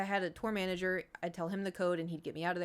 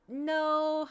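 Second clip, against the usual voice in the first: firm refusal; 9 semitones higher, 5 dB louder than usual. Retold or imitated. Refusing to give her number.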